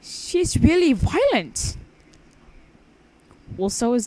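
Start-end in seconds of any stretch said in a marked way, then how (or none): 0.0-1.8 high, slow puppet complaint. Acted. Hand puppet complains about Alice, slow high voice. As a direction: drag it out sulkily.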